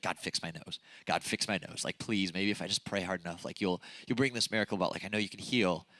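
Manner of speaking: low, hushed mock plea to God; mock pleading prayer. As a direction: pray like you're begging for a miracle. Mock desperate prayer on knees.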